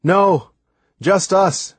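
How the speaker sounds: high-pitched call back. A narrator's reading. Boy answers the person on the boat, calling out. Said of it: "I called out".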